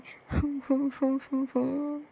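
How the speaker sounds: high, scornful little laugh. Acted. Old mother scoffing at the family's plot, high scornful laugh. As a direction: laugh off their schemes. After lament, mocking the family.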